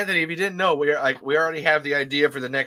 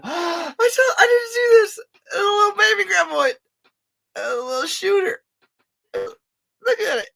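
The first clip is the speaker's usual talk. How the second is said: very high, tearful-sounding squeal; piercing delighted cry; overjoyed squeal; 5 dB louder than usual. Real, not acted. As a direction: squeal at an adorable discovery. Discovers a tiny toy.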